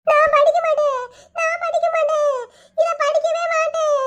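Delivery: very high, whining wail; crying refusal. Acted. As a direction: bawl like a child refusing to study. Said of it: "ஏப்பா அழாத". (படிக்க மாட்டேன் என்று அழும் குரல்)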